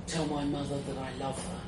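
soft, strained last words; faint deathbed voice, acted; dying, barely managing. A narrator's reading. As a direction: play a dying hero squeezing out a final request. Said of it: "I managed to say".

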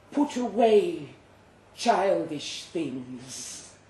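a gentle monster calming its prey; soothing creature voice. Acted. Creature voiced over narration.

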